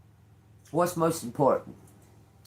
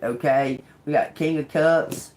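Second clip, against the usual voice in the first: usual speaking voice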